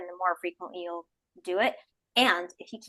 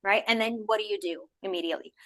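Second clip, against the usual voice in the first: usual speaking voice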